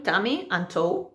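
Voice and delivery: tummy and toe said slowly like English words; slow English memory trick. Shown as a demonstration. Teacher sharing a learner's trick for ayuntamiento. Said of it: "os lo voy a escribir aquí".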